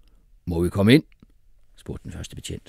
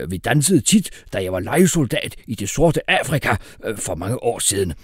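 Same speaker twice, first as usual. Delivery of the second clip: ordinary delivery, nothing marked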